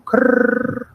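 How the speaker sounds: loud rolled "krrr"; long tongue-trilled R after a K. Retold or imitated. Teacher imitating a commercial, loud trilled "krrr". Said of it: "[name] did it best".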